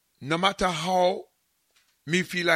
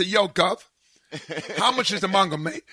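put-on British accent; 2 semitones higher than usual. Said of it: "I'm gonna come up and say".

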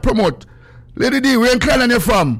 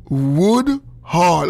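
"Wood Hall" said slowly, stretched out; slow, deliberate "Wood Hall"; slow demonstration; 3 semitones lower than usual. Shown as a demonstration. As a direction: draw out each word of "Wood Hall". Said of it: "That's how me say it slow".

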